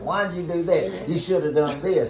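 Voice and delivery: loud, exasperated scolding, slowed down; fussing. Retold or imitated. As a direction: fuss at him like a frustrated dad. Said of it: "I fuss at him all the time".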